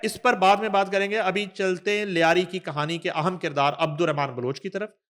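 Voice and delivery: usual speaking voice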